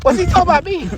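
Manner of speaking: high, loud offended question; can't believe he said that; indignant surprise. Acted. Player overhearing the coach call him an idiot, indignant.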